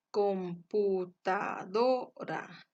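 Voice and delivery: computadora slowly in syllables, DO stressed; tonic syllable stressed. Shown as a demonstration. Teacher showing the tonic syllable, slow computadora with stressed DO. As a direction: draw out computadora, leaning on DO. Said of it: "la mayor intensidad de voz".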